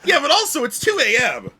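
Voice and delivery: exasperated. Real, not acted. Exasperated at the late hour.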